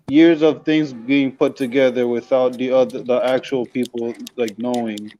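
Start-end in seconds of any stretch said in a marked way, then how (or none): none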